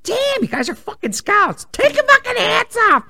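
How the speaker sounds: high, loud indignant rant; fed-up mom lecturing scouts; exasperated outburst. Retold or imitated. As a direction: let the annoyance burst out.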